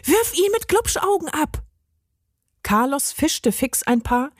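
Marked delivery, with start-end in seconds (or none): 0.0-1.6 high urgent order; calling out. A narrator's reading. Benny orders Carlos to throw eyeballs. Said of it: "rief Benny".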